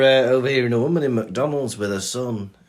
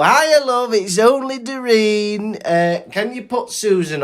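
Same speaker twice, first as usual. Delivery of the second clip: mock cheery old lady, much higher and louder, slow; Doreen impression; 10 semitones higher, 4 dB louder, slower than usual. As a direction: drag out the greeting sweetly. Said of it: "She'd go".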